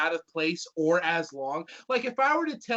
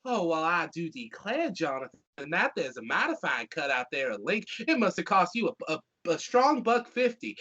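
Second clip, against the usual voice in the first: Southern accent. Shown as a demonstration. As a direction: say it like a Southern storybook character. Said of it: "I say it in a Southern accent".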